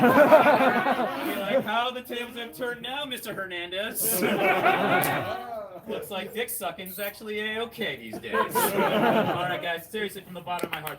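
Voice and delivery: high, loud, gloating through laughter; laughing gloat. Real, not acted. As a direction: rub it in, laughing.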